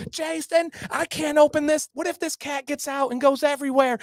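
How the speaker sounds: high, frantic exasperated wife; mock snapping back. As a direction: imitate a wife snapping at her husband. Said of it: "She snaps back at him".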